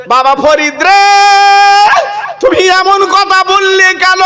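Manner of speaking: high-pitched call to Farid. A narrator's reading. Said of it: "এবার মেয়েটা ডেকে বলছে".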